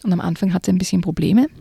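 ordinary talk

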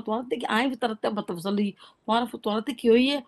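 ordinary delivery

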